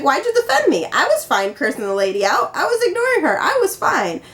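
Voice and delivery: mock protest in a high pitch; high-pitched retold indignation; indignant mother. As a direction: lightly imitate a mother insisting she was fine. Acting out her mother's indignant complaint.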